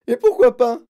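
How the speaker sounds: plus aigu et très fort; hurlé. Acted. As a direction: hurler. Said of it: "hurla madame Gloop". (Mme Gloop hurle à Wonka, très fort)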